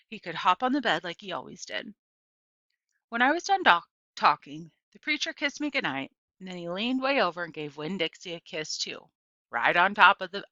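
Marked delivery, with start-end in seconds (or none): none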